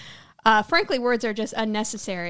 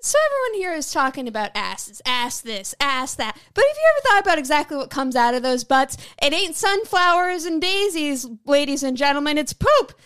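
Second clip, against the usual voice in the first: sneering wrestling villain. Pretend. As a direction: rant like a wrestling heel cutting a promo. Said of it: "do you wanna cut a promo?".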